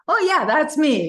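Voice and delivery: high, loud, delighted. Retold or imitated. To the piano store daughter, high and delighted. Confirming she's the famous author.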